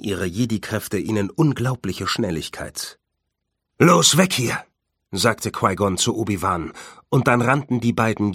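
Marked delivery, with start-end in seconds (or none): 3.8-4.6 raised, loud call to flee; pressing shout to get moving; urgent command. A narrator's reading. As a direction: bark a quick escape order. Jedi urges his partner to flee.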